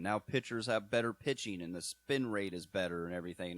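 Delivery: ordinary talk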